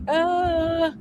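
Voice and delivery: high, drawn-out "uh"; stuck-for-an-answer whine on one held note; mock dithering groan. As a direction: let out a long, high-pitched uhh of indecision. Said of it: "And I'm like".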